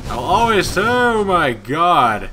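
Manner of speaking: loud, drawn-out exclamation. Real, not acted. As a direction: cry out, dragging it slowly. Fire bursts around player.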